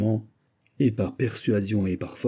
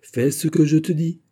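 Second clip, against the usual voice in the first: higher, louder smiling insistence; insisting with a smile; 7 semitones higher, 5 dB louder than usual. Acted. Lord pressing the seamstress, smiling insistence. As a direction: press her, grinning. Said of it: "insista-t-il en souriant".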